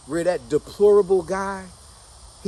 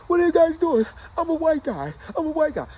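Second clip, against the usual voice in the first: panicked shout; 11 semitones higher than usual. Retold or imitated. Running from soldiers shooting.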